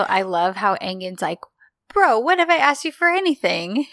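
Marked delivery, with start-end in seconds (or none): 1.9-3.9 high, incredulous protest to a friend; put-upon, high-pitched retort; mock indignant protest. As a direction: act out an offended bro, pitched up high. Acted indignant friend in retold scene.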